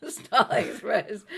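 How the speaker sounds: slow, loud and laughing. Real, not acted. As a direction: laugh out the joke title. Laughing joke suggestion.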